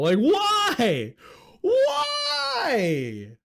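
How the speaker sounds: high, loud, drawn-out cry of disbelief; exasperated cry. Real, not acted. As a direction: wail it in sheer frustration. Exasperated rant peaks.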